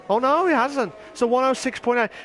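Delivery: ordinary talk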